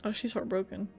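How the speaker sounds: normal talking voice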